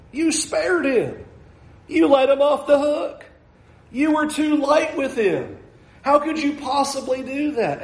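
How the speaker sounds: a hint of a resentful servant protesting; accusing complaint. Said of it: "that's what Gehazi's saying".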